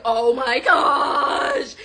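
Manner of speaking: slow, wailed exclamation; drawn-out mock horror. Woman caught naked by his friend, drawn-out horror. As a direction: stretch it into a horrified wail. Retold horrified reaction.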